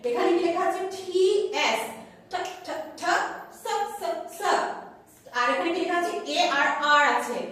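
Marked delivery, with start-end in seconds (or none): 2.3-4.4 soft, short "ঠ" and "স", each repeated alone; sounding out. Shown as a demonstration. Teacher showing the letters T and S, sounding out. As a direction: say "ঠ, ঠ, ঠ, স, স, স" softly, sound by sound.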